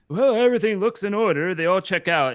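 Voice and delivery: a chipper bureaucrat checking papers; official's voice. Acted. GM voices Peter Peterson.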